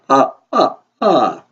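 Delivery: loud staccato vampire laugh; three separate, booming "ah" laughs; Count's laugh. Acted. Count laughing after saying a number, staccato vampire laugh. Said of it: "You don't have to laugh after everything you say".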